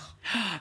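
sharp high gasp; shocked gasp. Real, not acted. Speaker gasps at co-host who never saw the film. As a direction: gasp in mock outrage.

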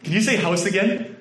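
high, laughing mockery; teasing laugh. Kentucky friends teasing him, laughing high. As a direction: poke fun at the way he says it. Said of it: "my friends being like".

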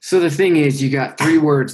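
desk bump mid-sentence; banging the desk. Real, not acted. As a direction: knock the desk while talking. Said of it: "Whoops, I just hit my, um, desk".

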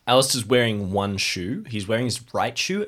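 ordinary talk, nothing marked

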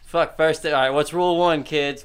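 ordinary talk

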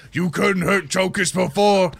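boastful warrior goading a monster; Tonkus. Acted. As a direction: taunt the enemy at full voice. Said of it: "encouraging Scarbrand to not pay attention".

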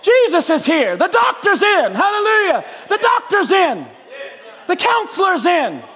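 building shouted proclamation; fervent preaching. Acted. Preaching at full force.

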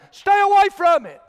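loud, high preacher's command; urgent warning. Real, not acted. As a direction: ring it out as an urgent plea.

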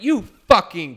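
loud angry outburst; yelled insult. Retold or imitated. Bartender yelling back at rude customer, loud. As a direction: snap it out loud and furious. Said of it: "turned around and went".

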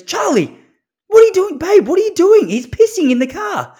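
put-on high, loud alarmed call at the child; wife's alarmed yell. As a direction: shriek it up high in shock at the mess. Wife catches son peeing in car.

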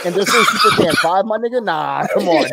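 high, laughing fake indignation; laughing mock outrage. Real, not acted. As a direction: rant while cracking up.

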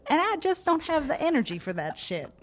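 high, loud southern twang; hammed-up southern accent. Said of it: "should I just go for it".

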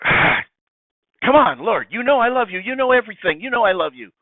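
high, loud, pleading protest; pestered exasperation. Acted. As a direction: protest like someone asked once too often. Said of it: "He's not just pestering him".

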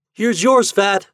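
put-on high, loud boyish call. A narrator's reading. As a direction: call across to a friend. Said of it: "called out Lin".

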